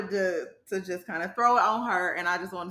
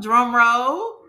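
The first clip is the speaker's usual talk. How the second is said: excited announcement; 9 dB louder than usual. Acted. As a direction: drum roll, said loud and grand. Announcing show name.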